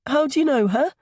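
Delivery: put-on very high child character voice; small boy's high voice. A narrator's reading. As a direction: pipe up like a little boy. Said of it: "Milo's high voice".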